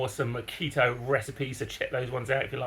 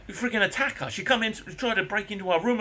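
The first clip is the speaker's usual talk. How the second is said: angry accusation; 8 semitones higher than usual. Retold or imitated. Confronting neighbour.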